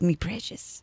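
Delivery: hushed little creature croon; Schmiegel. Acted. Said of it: "like, Schmiegel, 'cause he's like".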